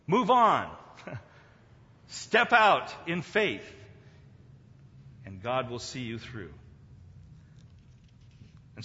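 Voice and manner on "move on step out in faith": high, loud call to move on; loud exhortation. Acted. Preacher urging believers to step out, loud call. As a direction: shout it as a command.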